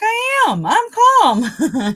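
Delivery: very high, loud retort; excited-sounding protest. Retold or imitated. Driver arguing back at a cop, high and excited. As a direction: squeal out your insistence, comically worked up. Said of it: "it sounds like I'm getting excited".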